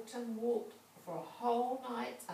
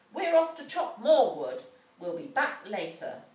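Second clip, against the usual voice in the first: stepmother's loud order; 10 dB louder than usual. A narrator's reading. Stepmother abandons children again.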